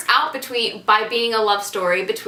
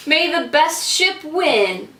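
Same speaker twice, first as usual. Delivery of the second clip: dramatic slogan; 5 semitones higher, slower than usual. Acted. Dramatic slogan to close intro.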